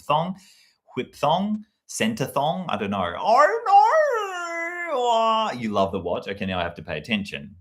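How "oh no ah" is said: high, hammed-up Australian "oh, no"; Australian accent. Keeping the Australian bit going in a high mock accent. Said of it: "this is definitely Australian".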